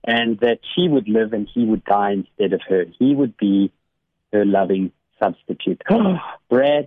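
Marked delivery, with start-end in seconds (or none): 5.9-6.4 gasp. Real, not acted. Moved by the boy's sacrifice.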